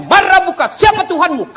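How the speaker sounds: high, loud interrogating angel; stern angel voice. Acted. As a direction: question the dead like a fearsome angel. (suara malaikat yang menginterogasi dengan keras)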